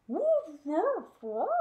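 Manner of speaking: loud playful puppy woofs; loud dog barks. Acted. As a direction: bark like an excited puppy. Puppy barking in story.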